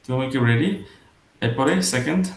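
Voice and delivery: normal talking voice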